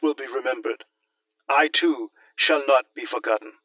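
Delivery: loud, defiant Senate speech; roman orator. Acted. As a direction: proclaim it like a condemned senator. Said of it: "Tacitus provides an account of Cremutius' own defense".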